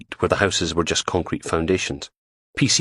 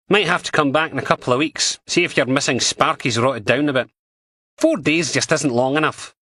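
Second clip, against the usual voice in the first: higher, brisk Scottish policewoman voice; PC Martin voice; 8 semitones higher than usual. Acted. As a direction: voice her as a blunt, scornful dog handler. Martin suggests coming back.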